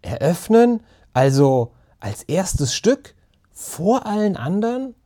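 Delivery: high, rising questions in disbelief; astonished. A narrator's reading. Ben hears their play opens the festival, astonished. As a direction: let each question climb, hardly believing it. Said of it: "verschluckte sich fast an seiner eigenen Überraschung".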